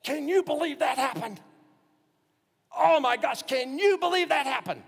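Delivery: loud, high-pitched amazed exclamation; emphatic disbelief. Shown as a demonstration. Preacher showing how we stress a thing, loud incredulous outburst. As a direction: exclaim it in astonishment, voice shooting up. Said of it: "you emphasize a word".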